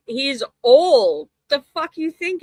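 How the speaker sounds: incredulous. Real, not acted. Defending Don's hair.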